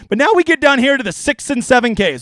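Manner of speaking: normal talking voice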